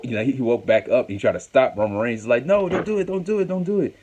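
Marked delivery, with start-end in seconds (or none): 2.5-4.0 high, pitched-up mock begging; imitated panicky high plea; frantic plea. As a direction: beg him to stop, voice way up high. Acting out brother begging Reigns.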